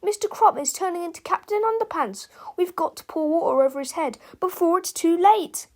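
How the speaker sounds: high-pitched alarm; urgent cry. A narrator's reading. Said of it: "cried Harold".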